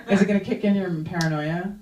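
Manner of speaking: ordinary talk, nothing marked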